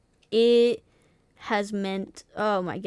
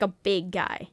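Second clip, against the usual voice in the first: usual speaking voice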